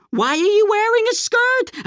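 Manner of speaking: high, sneering mock question. Quoting friends back home in a high mocking voice. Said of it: "everyone was all like".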